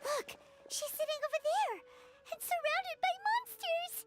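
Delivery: high-pitched alarm; alarmed cry. Acted. Spotting her surrounded by monsters, alarmed cry. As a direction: call out the danger, voice climbing. Spots friend among monsters.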